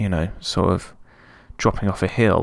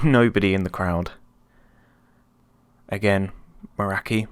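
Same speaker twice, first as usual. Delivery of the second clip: ordinary talk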